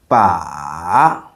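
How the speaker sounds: "ba" dipping low, then rising; long low scooping "ba"; third tone. Shown as a demonstration. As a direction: drop "ba" deep and bring it back up. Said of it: "it goes down, then up".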